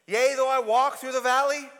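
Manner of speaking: loud, solemn psalm reading; psalm recitation. Acted. Preacher recites the psalm to the congregation, solemn and loud. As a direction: intone the line like scripture.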